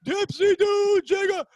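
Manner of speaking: loud, very high announcer yell; Dick Vitale. Retold or imitated. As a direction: imitate a hollering sportscaster cutting himself off. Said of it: "shoots, and goes".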